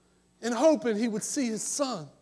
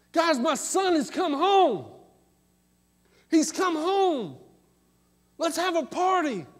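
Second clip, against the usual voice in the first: higher, loud, calling the servants with joy; father shouting for the servants to celebrate, loud and overjoyed; joyful hollering father; 7 semitones higher, 4 dB louder than usual. Retold or imitated. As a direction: holler out like an overjoyed dad calling across the yard. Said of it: "his dad was hollering".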